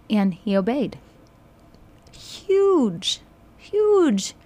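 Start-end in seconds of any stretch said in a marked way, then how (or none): 2.1-4.3 much higher, gleeful cry of disbelief; exclaiming huge in amused amazement; amazed exclaiming. Real, not acted. Amazed at Ahab obeying Elijah.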